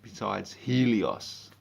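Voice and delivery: ordinary talk, nothing marked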